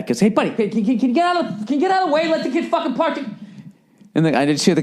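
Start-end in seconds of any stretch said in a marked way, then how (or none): 0.2-3.3 high-pitched yell thrown off to the side; imitated yelling. Friend yelling at the ticket guy to clear the way, mock high yell. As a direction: mimic a worked-up buddy yelling at someone in his way. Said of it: "Jay starts yelling at the ticket guy".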